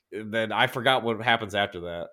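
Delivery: usual speaking voice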